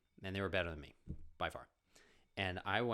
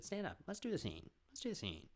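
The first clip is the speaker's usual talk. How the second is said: high teacher command; 12 semitones higher, 3 dB quieter than usual. Retold or imitated.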